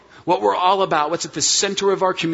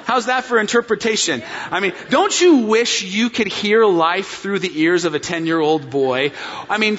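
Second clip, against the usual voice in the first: ordinary talk, nothing marked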